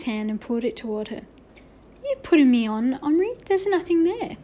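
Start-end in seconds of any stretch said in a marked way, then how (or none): 2.0-4.4 incredulous. Acted. Teacher's disbelief voiced.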